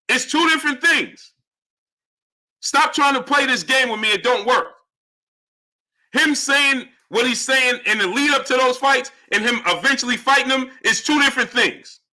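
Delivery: high, heated argumentative voice; exasperated insistence. Real, not acted. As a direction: hammer the point at a stubborn viewer. Arguing back at a viewer.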